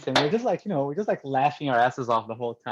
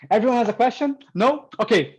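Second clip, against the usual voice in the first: higher and louder, a breezy rushed teacher; hurried teacher; 9 semitones higher, 6 dB louder than usual. Retold or imitated. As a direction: sail past the question pause like an impatient teacher. Imitating teacher rushing past questions.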